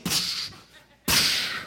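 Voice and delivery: imitated puddle splash, a breathy psh; splash noise. Preacher imitating boy in the mud puddle, splash noise. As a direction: mimic a splash with a burst of air.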